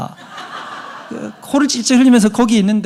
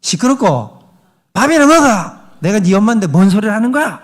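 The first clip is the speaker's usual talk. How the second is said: higher, louder scolding mother; brusque mother; 3 semitones higher, 5 dB louder than usual. Retold or imitated. Mother brushing off her son, louder and higher. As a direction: snap it off like a mom ending talk. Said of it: "반응이 뭐라 그랬냐면".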